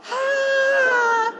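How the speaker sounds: long high mock scream. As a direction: wail it high like a rider on the drop. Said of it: "the next one comes".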